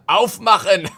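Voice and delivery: high, loud German order through laughter; mock German command. Streamer playing German police for laughs, high loud German command. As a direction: bark it like a pretend German cop.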